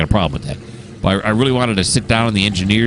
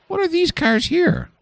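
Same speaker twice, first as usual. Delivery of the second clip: puzzled squeak. Real, not acted. Puzzled by cars in yard.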